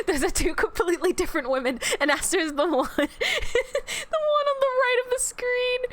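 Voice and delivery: words breaking up with laughter, ending high; can't stop laughing while explaining; laughing through words. Real, not acted. Telling chat which one she is, laughing.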